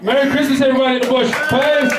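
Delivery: high, shouted farewell to the crowd; shouted sign-off. Real, not acted. Comic closing the set to the audience, shouted farewell. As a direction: call out goodbye to the whole room.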